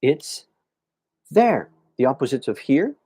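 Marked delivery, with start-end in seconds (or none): 1.3-1.7 "there" said clearly, high and falling; demo pronunciation. Shown as a demonstration. Teacher showing students how to say "there", careful demo. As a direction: model the word "there" slowly for learners. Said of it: "when I say this word".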